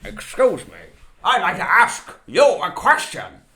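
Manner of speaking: bum-talking voice. Retold or imitated. A child talking to his mother out of his bum, cartoon-style. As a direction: ham up a voice coming out of your backside. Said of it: "talking to my mother out of my bum".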